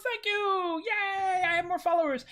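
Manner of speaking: high, bright thank-you and cheer; giddy high-pitched celebration; squeaky mock cheer. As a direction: squeal like a thrilled kid. Celebrating a new follower.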